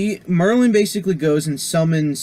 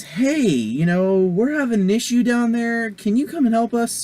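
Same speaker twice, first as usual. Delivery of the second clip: pleading call; 9 semitones higher than usual. Retold or imitated. Merlin calls knights for help.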